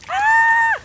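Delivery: very high, loud squeal; real happy scream; shriek of joy. Real, not acted. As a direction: squeal with delight.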